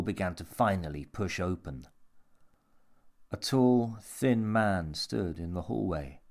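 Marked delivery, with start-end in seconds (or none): none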